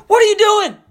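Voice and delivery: very high, startled-sounding challenge; Holy Ghost's rebuke. Acted. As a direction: pitch it way up as a pointed divine call-out. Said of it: "you know what the Holy Ghost said?".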